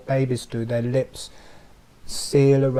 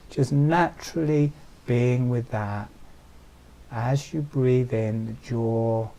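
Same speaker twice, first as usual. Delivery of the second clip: guided meditation. Acted. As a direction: soothe them into letting go. Eyes closed, deep relaxation guidance.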